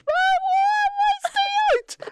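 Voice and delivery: very high, very slow wail; high drawn-out sobbing why; crying plea. Acted. Crying henchman begging Batman.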